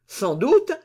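high, loud outburst; exclaiming. Acted. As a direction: blurt it out with feeling. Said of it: "s'écria le gouverneur de la Bastille".

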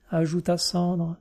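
ordinary delivery, nothing marked

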